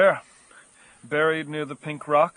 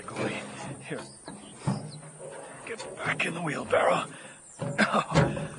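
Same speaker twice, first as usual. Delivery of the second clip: ordinary talk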